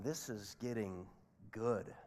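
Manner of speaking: ordinary delivery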